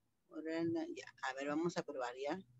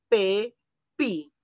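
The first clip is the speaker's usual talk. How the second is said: ordinary talk, nothing marked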